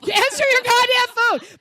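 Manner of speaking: loud, high, slow furious shout; angry yell. Retold or imitated. Mom yells at her husband who won't pick up, furious. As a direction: bellow it at the unanswered phone. Yelling at husband not answering.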